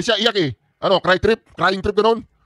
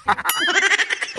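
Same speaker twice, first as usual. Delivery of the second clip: mocking laugh; 9 semitones higher, 5 dB quieter than usual. Real, not acted. Laughing at the meet and greet.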